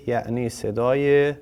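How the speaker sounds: ordinary delivery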